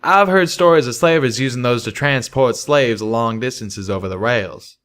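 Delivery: ordinary delivery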